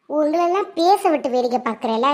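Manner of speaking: ordinary talk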